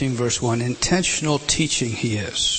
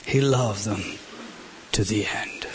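whisper; 4 semitones lower, 5 dB quieter than usual. Real, not acted. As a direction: whisper "to the end". Climax of loved them.

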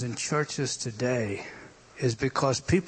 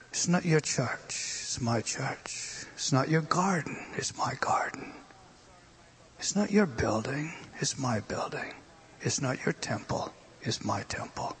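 hushed whisper; 7 dB quieter than usual. Real, not acted. Preacher whispering God's claim to the congregation. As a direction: whisper each line, fading softer.

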